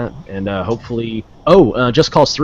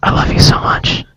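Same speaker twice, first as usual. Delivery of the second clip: voiceless stage whisper; playful whispered declaration of love; mock intimate whisper; 4 dB louder than usual. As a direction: whisper it creepily close, in jest. Same words whispered to co-host.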